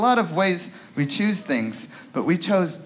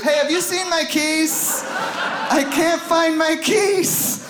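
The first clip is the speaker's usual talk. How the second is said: frantic parent; 10 semitones higher, slower than usual. Retold or imitated. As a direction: call out in a panic for the keys. Parents searching for keys.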